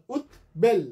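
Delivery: উদ্বেল split as ud, bel; syllable by syllable. Shown as a demonstration. Teacher showing two syllables, ud then bel. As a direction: say উদ্বেল in two separate beats. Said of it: "দুটো syllable".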